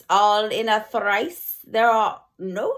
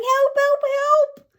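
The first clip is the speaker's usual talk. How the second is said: shrill high cries of help; mock yelling for help; 5 dB louder than usual. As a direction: squeal it like panicked teachers.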